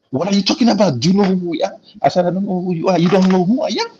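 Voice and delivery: indignant retold argument; retold confrontation. Retold confrontation with armed men.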